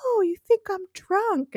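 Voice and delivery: high, mock-offended tipsy woman; drunk voice. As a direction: play the drunk, indignant and high. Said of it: "She's like".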